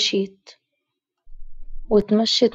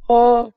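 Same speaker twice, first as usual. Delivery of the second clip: short pained cry; 3 semitones higher, 5 dB louder than usual. Acted. Girl attacked, cries out.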